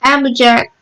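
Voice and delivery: usual speaking voice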